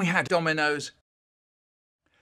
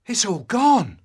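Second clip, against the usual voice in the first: dismay; 4 semitones higher, 6 dB louder than usual. Acted. Dismay at loss.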